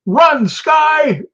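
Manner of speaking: high yell across the street; shouted cheer from afar; retold yell. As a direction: call out to someone down the block. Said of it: "and I yell".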